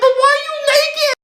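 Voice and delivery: very high mock shriek; outraged mom. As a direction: shriek it like a scandalized mother. Mom shrieking at naked daughter.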